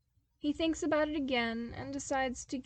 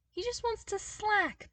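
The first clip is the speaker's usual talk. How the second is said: whiny complaint; 12 semitones higher, 3 dB louder than usual. Acted. Narrator whines Cale's wish.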